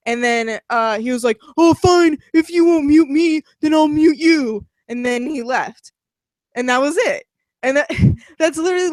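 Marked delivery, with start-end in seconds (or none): 1.6-4.6 higher, louder imitation of a petulant gamer; mock huffy retort. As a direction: mimic a sulking player giving up. Imitates harasser giving up, huffy.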